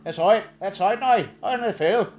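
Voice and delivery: high, loud mock Northern Irish; Northern Irish accent. Imitating the baffling phone woman, in high Northern Irish. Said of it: "But she said".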